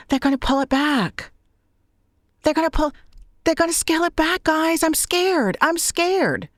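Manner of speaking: high whiny mock voice; high-pitched mock panic about losing money; mocking scared creator. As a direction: squeak it out like a frightened sellout. Mocking fearful creators losing subscriptions.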